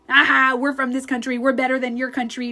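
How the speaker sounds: mock boasting. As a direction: crow over a rival in playful mockery. Acting out rival countries bragging.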